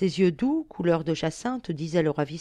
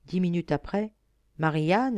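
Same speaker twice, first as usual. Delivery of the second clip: ordinary delivery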